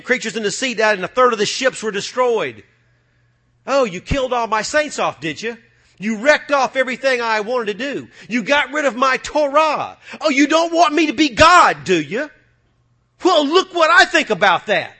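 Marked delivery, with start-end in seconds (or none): 3.7-14.9 angry mocking God. God voiced taunting his enemies.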